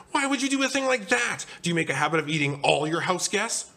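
high, scolding donut tirade; outraged high-pitched questioning; shrieking indignation. Acted. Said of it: "Arnie shrieked".

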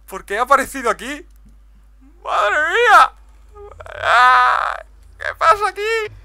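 high, loud disbelieving yells; shocked shouting. Real, not acted. Shocked by a duplicated portal.

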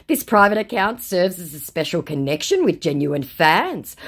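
high, syrupy mock celebrity voice; mock Gerard Butler fan message. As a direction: read it like a fake star gushing to fans. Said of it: "meet Gerard Butler".

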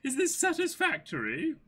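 high, loud, formal servant voice; goat butler voice. Acted. Butler reporting the errand to Kaya, high and formal. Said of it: "the voice for the goat butler".

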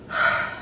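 heavy mimicked sigh; imitated sigh. Cashier handed all the quarters, imitated exasperated sigh. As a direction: let out an annoyed cashier's sigh. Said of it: "sighs or whatever".

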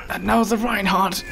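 light nature documentary narrator; hushed wildlife-film narration, lightly imitated; David Attenborough. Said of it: "if he was narrating this right now".

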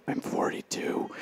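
slow whisper; whispered confession. Pretend. Speaker confiding his age to the audience, slow whisper. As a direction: whisper it like a secret.

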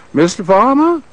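high, lilting Southern lady on the phone; Southern female voice. Retold or imitated. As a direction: play the president's Southern secretary placing a call. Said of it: "Southern female voice said".

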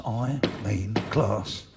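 "class" drawn out very slowly; stress on class. Acted. Comic telling the crowd about the pub's bit of class, slow stress on "class". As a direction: stretch out the word "class" for emphasis. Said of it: "when I say class".

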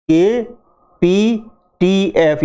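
"के पी टी एफ़" spelled slowly, letter by letter; slow letter list. Shown as a demonstration. Teacher spells the letters slowly for the class. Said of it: "के पी टी एफ़ आते हैं".

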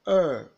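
loud, sustained "Er" with a falling tune; long er vowel. Shown as a demonstration. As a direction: model "Er" for repeating, held long and forceful. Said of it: "unrounded vowel".

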